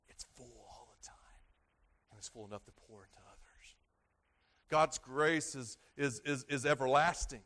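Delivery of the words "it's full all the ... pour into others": whispered, softer, lower. Acted. Pastor to congregation, whispering softly about grace. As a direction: hush it like a secret comfort.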